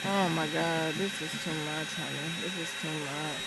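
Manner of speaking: soft, low, worn-out murmur; exhausted murmur. Real, not acted. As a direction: sigh it out, drained. Exhausted after the spider.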